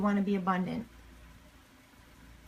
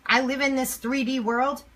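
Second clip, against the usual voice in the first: ordinary talk, nothing marked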